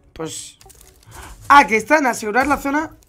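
ordinary delivery

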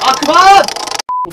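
loud, high panicked yell; pleading loudly for it to stop. Real, not acted. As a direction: shout at him to quit it. Yelling at the annoying kid.

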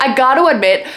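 ordinary delivery

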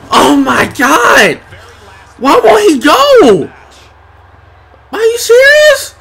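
high, loud wail; real outraged shouting; exasperated yelling. Real, not acted. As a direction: build to a squeal of disbelief. Opponent kicks out again.

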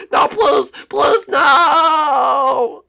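loud, high pleading with a sob; panicked begging. Acted. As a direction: beg for your life, crying out. Captive begs, crying out.

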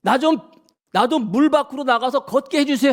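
high-pitched, acted appeal; Peter's eager plea. Peter asking Jesus to let him walk on water, acted high plea. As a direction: call up to the master with an eager request. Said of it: "베드로가".

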